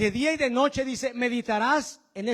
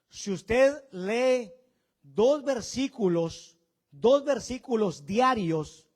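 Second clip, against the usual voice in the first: 1 slow emphatic teaching; 2 semitones higher, 6 dB louder, much slower than usual. Shown as a demonstration. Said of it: "slow emphatic teaching of numbers".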